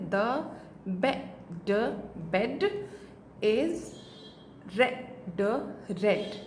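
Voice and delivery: each word said apart with pauses; halting beginner read. Shown as a demonstration. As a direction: sound out one word at a time. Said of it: "इस तरह से बच्चा book reading करेगा".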